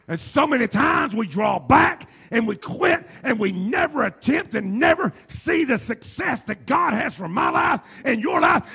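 loud, high preaching cry. Acted. Preacher shouting the sermon to the church. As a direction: preach it out to the congregation.

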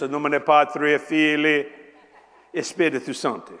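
loud intoned Latin like a priest; mock Latin Mass. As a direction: chant it like the old Mass. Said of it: "you heard this".

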